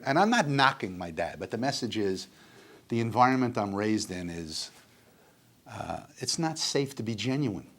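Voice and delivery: ordinary delivery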